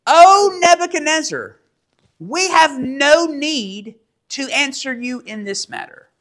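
high, measured declamation; solemn scripture reading. Acted. Three young men answering the king together, declaimed formally. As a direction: proclaim it as a formal joint answer. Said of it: "all three in unison, kind of together".

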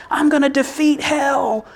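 high, driven battle vow; heroic boast. Retold or imitated. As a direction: swagger like you'll storm hell itself. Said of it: "we want that moment where it's like".

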